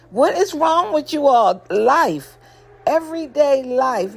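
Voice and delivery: high, exasperated what-is-wrong; incredulous outburst. Real, not acted. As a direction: throw up your hands at them. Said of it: "host reacts to couples".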